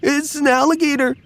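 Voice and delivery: very high, panicked alarm call; squirrel yelling. Acted. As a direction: warn the whole forest in a shriek. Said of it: "and yelled".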